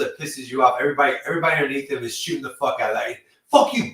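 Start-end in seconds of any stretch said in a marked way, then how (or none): 3.5-3.9 loud, high defiant curse; mock gangster yell. Mock gangster yelling fuck you at the end. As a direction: spit it out like a dying mobster. Gangster movie shooting scene.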